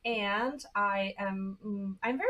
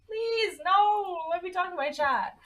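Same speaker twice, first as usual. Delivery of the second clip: pleading; 8 semitones higher, 3 dB louder than usual. Retold or imitated. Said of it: "I'm like".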